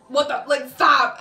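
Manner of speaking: sudden loud stop; exasperated stop. Retold or imitated. Telling the boyfriend to stop, exasperated loud.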